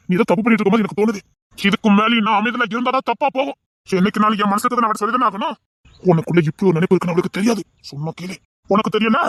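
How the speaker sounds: normal talking voice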